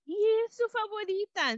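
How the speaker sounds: high, happy bee voice. A narrator's reading. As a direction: chirp it joyfully. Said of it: "con alegría".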